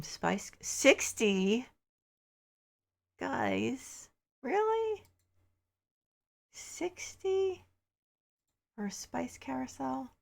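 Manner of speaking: shrill high squeal of disbelief, drawn out; can't believe the price, pitch way up; outraged disbelief. Real, not acted. Vlogger balks at a sixty-dollar spice carousel, squealing in disbelief.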